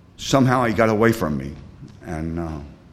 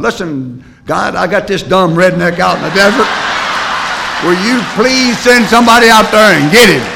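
loud, high plea building to a shout; dog's exasperated prayer; 9 semitones higher, 9 dB louder than usual. Acted. Dog in truck begs God to rescue its owner, hammed-up exasperation. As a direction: beg heaven, louder and higher as you go. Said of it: "Buddy's back in the truck going".

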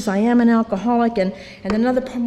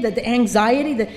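ordinary talk, nothing marked

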